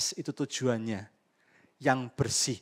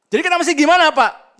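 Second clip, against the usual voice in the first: much higher, much louder mock plea from the pews; congregant asking; 18 dB louder, faster than usual. Preacher voicing a congregant asking him what to do, loud high call. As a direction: cry it out like an eager listener asking the pastor.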